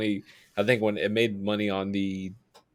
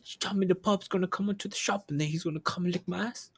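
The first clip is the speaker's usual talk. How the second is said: tough-guy movie quote; 7 semitones higher, 5 dB quieter than usual. Retold or imitated. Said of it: "he's like".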